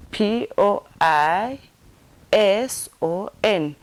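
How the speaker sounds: slow, spaced-out letters; spelled out. Shown as a demonstration. Teacher finger-spelling poison for learners, spelled out. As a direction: spell it one letter at a time. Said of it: "Let me repeat it again".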